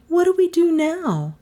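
high-pitched quizzical woman; quizzical Melissa. Acted. Visitor at the door, high and quizzical. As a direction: ask in a high character voice.

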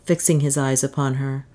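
usual speaking voice

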